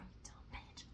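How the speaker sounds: whispered jab at herself; mock self-scolding. Telling herself off for rambling, whispered. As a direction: toss a whispered insult at yourself.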